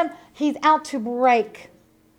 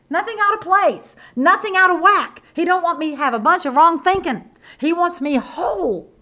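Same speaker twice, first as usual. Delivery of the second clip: preaching at full force; 4 semitones higher than usual. Acted. Preaching build-up.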